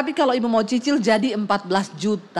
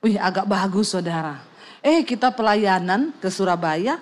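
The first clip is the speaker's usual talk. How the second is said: usual speaking voice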